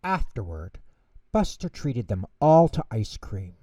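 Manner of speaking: plain reading voice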